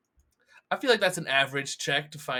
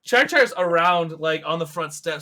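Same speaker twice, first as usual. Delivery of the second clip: ordinary talk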